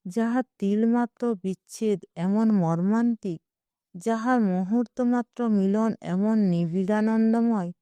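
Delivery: plain reading voice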